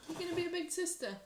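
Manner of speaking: high, quick cooing to the dog; pet talk voice. Acted. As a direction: sing it up to the pet.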